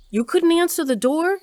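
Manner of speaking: high, loud, indignant question; exasperated. Acted. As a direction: demand it in exasperated disbelief. Mother scolds daughter in kitchen.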